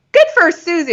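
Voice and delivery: high, generous cheer for a friend; congratulatory, pitched well up; celebrating someone. Acted. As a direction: lift it up high, pleased for her. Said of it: "not in this celebrating mode".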